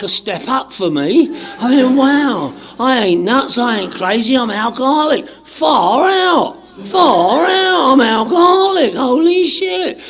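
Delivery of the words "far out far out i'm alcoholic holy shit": higher and louder with delight; excited. Retold or imitated. Recalling his thrill at being an alcoholic, excited. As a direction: burst out with joy at the news.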